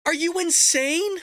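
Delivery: slow, high, disbelieving outburst; incredulous outrage. Real, not acted. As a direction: demand it like she's lost her mind. Ranting at press secretary clip.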